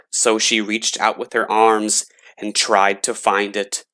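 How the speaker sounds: ordinary delivery, nothing marked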